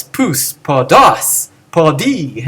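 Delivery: podos and podi punched on the last syllable; old memorized accenting. Shown as a demonstration. Teacher reciting the old unnatural forms, stressing endings of podos, podi. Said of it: "before where you had to memorize".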